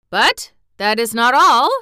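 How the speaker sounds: loud, proud announcement. A narrator's reading. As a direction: announce it loudly, with pride. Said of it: "said the general, proudly".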